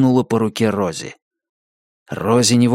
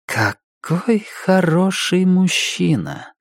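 slow, higher musing; thoughtful; 8 semitones higher, much slower than usual. A narrator's reading. Роза Марена reflecting on the man, thoughtfully. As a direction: muse aloud, drawing the words out. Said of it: "задумчиво проговорила Роза Марена".

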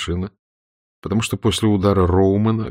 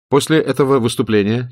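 higher, formal news-bulletin read; radio announcer; 5 semitones higher than usual. Acted. A London radio announcer reads the news, formal. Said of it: "читал лондонский диктор".

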